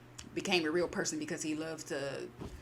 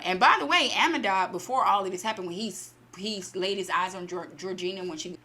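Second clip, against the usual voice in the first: ordinary delivery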